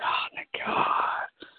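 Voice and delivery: slow breathy whisper of relief. Retold or imitated. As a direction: sigh it out, whispering. Imitating relief at confirmation.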